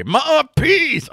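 high, loud sarcastic "Ma peace"; a high, loud jab; mock ironic photographer. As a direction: toss it off with loud mock irony. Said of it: "ironically enough".

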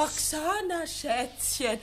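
mocking echo of his pet name; wife mimicking her husband's pet name, mocking; mock imitation. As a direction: parrot him sarcastically. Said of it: "hör doch auf mit dem albernen Schätzchengetue".

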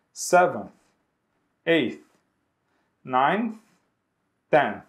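each ordinal alone with long pauses; model for repetition. Shown as a demonstration. Teacher leading class repetition of ordinals, one by one. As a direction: pause after each so they repeat. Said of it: "Repitam comigo".

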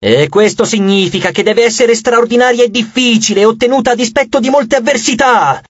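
high, imperious tirade; indignant goddess. Acted. Goddess lecturing Leo on true victory, indignant.

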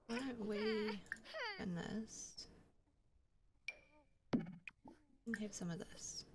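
slow coaxing whisper. Real, not acted. Feeding baby sim.

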